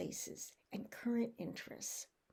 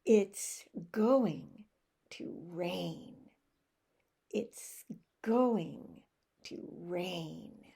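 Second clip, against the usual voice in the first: slow chunks with pauses, GOING punched; finger technique; 4 semitones lower, much slower than usual. Shown as a demonstration. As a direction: break it into pieces for learners to follow. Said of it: "I use the finger technique".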